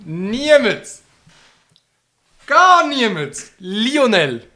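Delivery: high, shrill mock shout; mock shrieking. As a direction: parody the friend's panic. Mocking friend's spicy agony. (spöttisch kreischend)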